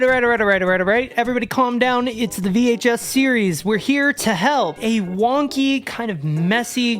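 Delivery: ordinary delivery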